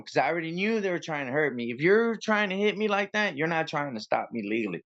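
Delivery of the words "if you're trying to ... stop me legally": normal talking voice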